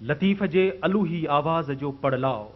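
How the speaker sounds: ordinary delivery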